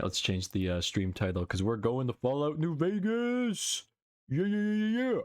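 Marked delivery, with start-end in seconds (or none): 1.6-5.2 rising, thrilled cheer; excited. Real, not acted. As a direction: hype it up with a climbing yeah. Excited about the next game.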